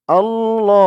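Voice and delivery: "আল্লাহ" with a lengthened second vowel; "আল্লাহ" with a measured long final vowel; one-alif stretch. Shown as a demonstration. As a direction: hold the "lo" of "আল্লাহ". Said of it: "ল এক আলিফ টান".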